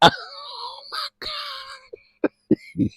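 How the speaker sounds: high laughing oh my God. Real, not acted. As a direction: lose it laughing. Laughing fit.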